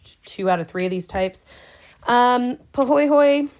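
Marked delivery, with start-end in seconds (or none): 2.7-3.5 "pahoehoe" higher and louder, said pah-hoy-hoy; Hawaiian pronunciation. Shown as a demonstration. Teacher introduces a Hawaiian lava term, carefully pronounced "pahoehoe". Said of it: "it's pronounced pahoehoe".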